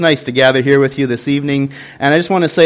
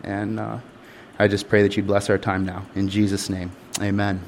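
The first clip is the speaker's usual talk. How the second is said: quiet prayer; 5 semitones lower, 10 dB quieter than usual. Real, not acted. Closing prayer before the sermon.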